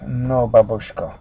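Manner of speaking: put-on slow, loud Russian-accented refusal; measured Russian accent. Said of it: "Russian accent".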